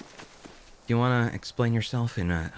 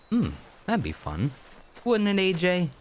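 high playful voice; 9 semitones higher than usual. Acted. Playful talk to game kid.

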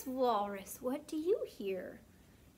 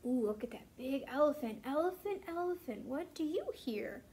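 ordinary delivery

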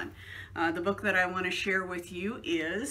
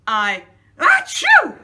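sneeze; 6 semitones higher, 15 dB louder, slower than usual. Acted. Gerald sneezes.

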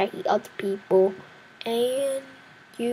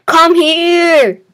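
loud, high call; excited chase yell; 7 semitones higher, 10 dB louder than usual. Real, not acted. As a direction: yell it at the fleeing player. Chasing a player in game.

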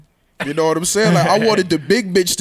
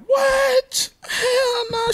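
much higher, crying-out disbelief; outraged surprise; much slower than usual. Retold or imitated. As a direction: squeal it in shocked outrage. Singer hears about the slap.

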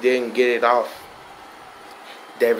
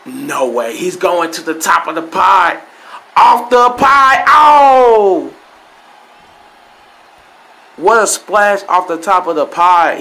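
rising to a loud, high shouted count; wrestling commentator; 3 semitones higher, 5 dB louder than usual. Real, not acted. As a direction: call the finish like a ringside announcer. Live play-by-play of the match finish.

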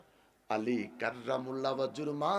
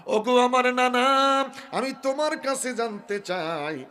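impassioned plea; 11 semitones higher, 10 dB louder than usual. Acted. Husain appealing to his grandfather, pleading call. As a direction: open loud and stretched, then settle.

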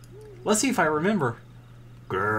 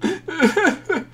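laughing; 4 dB louder than usual. Real, not acted. Mock sobbing laugh.

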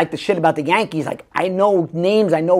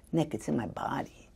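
a hint of an intense brother, much softer; 2 semitones lower, 14 dB quieter than usual. Brother explaining his method, hushed and intense. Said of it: "he's kind of intense".

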